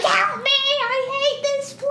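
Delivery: high, pleading cry for help; panicked cry. Retold or imitated. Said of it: "and it's like".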